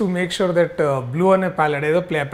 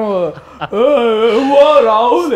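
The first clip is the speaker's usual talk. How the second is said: loud, high, drawn-out mock holler; imitating Jeevan offstage; 6 semitones higher, 8 dB louder, much slower than usual. Host mimics his friend after leaving the stage, hollering. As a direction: call out in a high, booming put-on voice. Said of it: "stage కి దిగిన తర్వాత మొత్తం".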